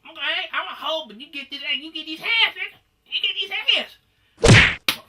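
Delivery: high, loud hammed-up threat; threatening. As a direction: square up and yell it, mocking. Retold fight, Marlon's threat.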